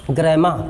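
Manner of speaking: ग्रामर said "gray-ma", no final r; English-style pronunciation. Shown as a demonstration. Teacher answering own question on reading, ग्रामर as "gre-maa". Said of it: "क्या पढ़ते हैं पता है?".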